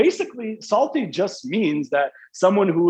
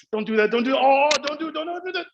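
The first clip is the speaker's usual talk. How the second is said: rising higher and higher, pleading at the screen; cringing plea; 10 semitones higher than usual. Acted. Viewer cringing at a movie scene, pleading ever higher. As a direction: beg the character to stop, squirming.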